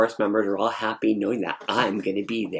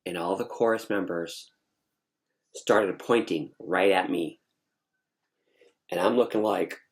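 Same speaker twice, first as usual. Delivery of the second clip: ordinary delivery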